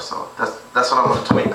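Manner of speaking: ordinary delivery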